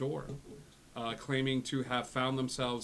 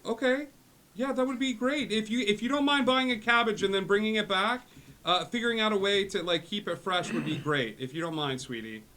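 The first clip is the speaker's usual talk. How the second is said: high, loud wheedling easing down; doting dad; 10 semitones higher, 8 dB louder than usual. Acted. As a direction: ask a favour in a high dad voice, settling at the end. Plays father on sending stone.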